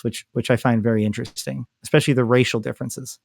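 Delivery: ordinary talk, nothing marked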